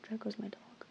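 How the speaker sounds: soft whispered aside. Real, not acted. Whispering to viewers about her dog.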